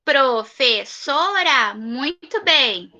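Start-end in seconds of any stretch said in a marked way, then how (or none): 0.0-1.7 slow repeat. Shown as a demonstration. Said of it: "teacher repeats word slowly".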